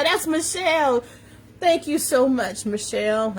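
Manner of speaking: ordinary talk, nothing marked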